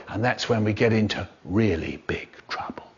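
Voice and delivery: hushed whispered ending. A narrator's reading. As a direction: breathe the last line as a secret.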